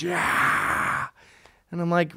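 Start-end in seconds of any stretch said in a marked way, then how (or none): 0.0-1.1 high, drawn-out hoarse crowd yell; imitated crowd cheer. Punk crowd answering the singer, imitated cheer. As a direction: cheer like a whole audience at a gig. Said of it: "And everyone goes".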